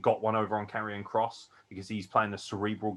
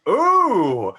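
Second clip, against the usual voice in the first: taunting heel; 11 semitones higher, 10 dB louder, much slower than usual. Retold or imitated. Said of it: "he's just like".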